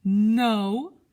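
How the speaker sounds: loud, emphatic no; emphatic dentist's no; firm no. Acted. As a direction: cut him off with a flat no. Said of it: "said the dentist".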